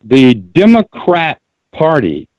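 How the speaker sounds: Democrat said slowly and firmly; stress on Democrat. Shown as a demonstration. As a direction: make sure Democrat, not Democratic, is heard. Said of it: "and I said Democrat Party".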